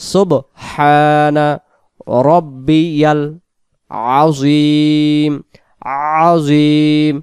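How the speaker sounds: আজিম said slowly, its ain pressed in the throat. Shown as a demonstration. Teacher showing the throat-pressed ain, slow. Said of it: "এটা আইন অক্ষর গলা চেপে হবে".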